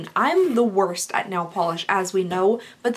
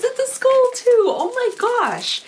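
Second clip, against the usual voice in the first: excited; 13 semitones higher, 4 dB louder, slower than usual. Real, not acted. Delighted at an unboxed item.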